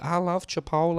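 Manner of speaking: high, loud little-boy impression; put-on excited child's voice. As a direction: pipe up like the kid in a video. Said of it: "I sound like that boy".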